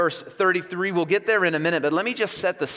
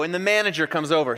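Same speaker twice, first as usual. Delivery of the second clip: ordinary talk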